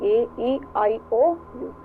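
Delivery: ordinary talk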